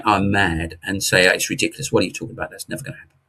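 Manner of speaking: mocking scoffers. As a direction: brush the idea off as nonsense, under your breath. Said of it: "everybody's gonna say".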